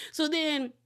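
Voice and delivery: ordinary talk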